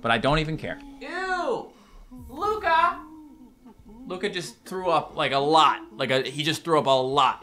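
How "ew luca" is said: high, soft grossed-out squeal; real disgust at the dog. Real, not acted. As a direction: recoil at the mess. Dog just vomited.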